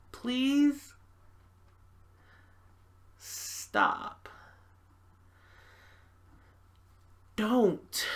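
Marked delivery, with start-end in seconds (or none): 3.2-4.3 low, whispered stop; hushed plea. Acted. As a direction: breathe the command out under your voice. Begging the suitor to stop.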